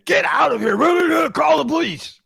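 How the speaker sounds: high, loud panicky shout; panicky boss. Retold or imitated. Boss threatened in his office, high panicky shout. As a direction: yell it in a panic.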